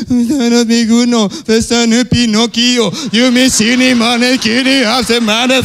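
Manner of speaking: slow, garbled sobbing nonsense; slow blubbered gibberish, like a stalling engine; mock crying gibberish. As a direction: parody words lost in tears. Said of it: "Coba ngomong sekarang apa?".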